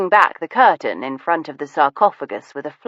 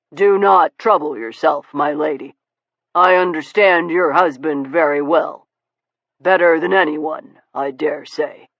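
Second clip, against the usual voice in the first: gentleman's voice. A narrator's reading. Steele calming lady.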